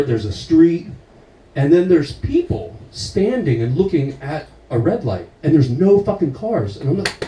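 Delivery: usual speaking voice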